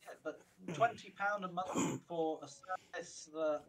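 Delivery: ordinary talk, nothing marked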